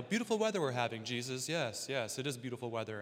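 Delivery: soft, low chit-chat; casual small talk. Acted. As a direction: make idle conversation, trailing down quietly. Imagined small talk on the hike.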